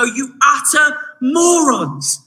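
slow, drawn-out mock outburst at the Galatians; exasperated insult. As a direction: spit out the insult in mock disbelief. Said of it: "I look at the Galatians and I go".

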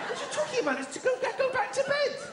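high-pitched incredulous scolding; exasperated father. Acted. Father to son awake at night, exasperated. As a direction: protest up high in disbelief.

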